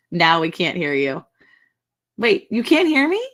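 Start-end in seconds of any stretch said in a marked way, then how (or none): none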